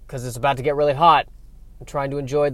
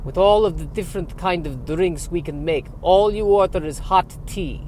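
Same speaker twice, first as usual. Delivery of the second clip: put-on incredulous echo building to higher scorn, Dracula-style accent; scornful Eastern European vampire accent; 3 semitones higher than usual. Vampire barista disdaining a simple tea order, scornful Eastern European accent. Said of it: "question about the accent".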